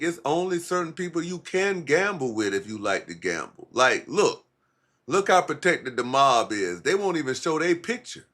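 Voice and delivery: ordinary delivery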